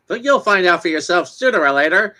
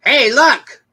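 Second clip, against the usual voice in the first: excited call; 9 semitones higher than usual. Acted. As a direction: hail them gladly. Neighbour cheers the new couple.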